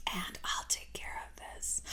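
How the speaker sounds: very soft whisper; conspiratorial whisper. Acted. Daughter taking over mom's dating login, whispering. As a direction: drop to a secretive whisper.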